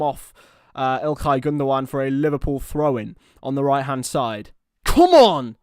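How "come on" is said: louder, higher rallying cry; real fan cheer; urging shout. Real, not acted. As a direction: roar the team on. Fan urging his team on.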